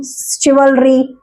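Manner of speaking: teacher's slow model of शिवलरी; correct pronunciation. Shown as a demonstration. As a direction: spell out शिवलरी slowly, starting with sh. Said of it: "शिवलरी इतना easy word है".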